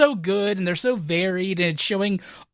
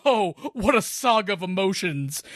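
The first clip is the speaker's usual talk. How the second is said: normal talking voice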